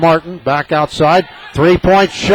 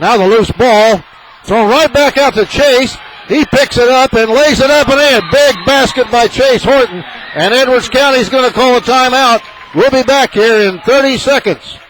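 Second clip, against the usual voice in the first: high, loud play-by-play; thrilled announcer's rising call; excited call; 8 semitones higher, 3 dB louder than usual. Real, not acted. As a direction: ride the big basket into the break. Big basket, timeout call.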